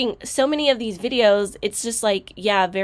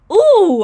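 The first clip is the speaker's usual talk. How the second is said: exasperated ooh; 11 semitones higher, 12 dB louder than usual. Real, not acted. Exasperated at the woman.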